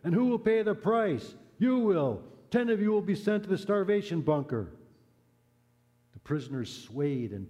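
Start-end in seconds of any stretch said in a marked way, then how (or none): 0.0-4.7 high, loud furious commandant yelling. Acted. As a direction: bark the threat at the prisoners. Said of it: "screamed at them in fury".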